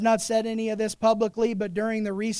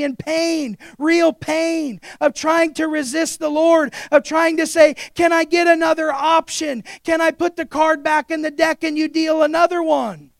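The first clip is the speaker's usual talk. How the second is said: higher, louder fervent preaching climax; full-force preaching; 7 semitones higher, 8 dB louder than usual. Acted. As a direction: drive it home to the congregation.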